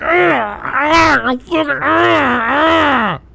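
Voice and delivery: imitated raging groans, strangled words; mock meltdown. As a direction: groan as if mimicking someone's meltdown. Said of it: "did you see the type of meltdown she was having".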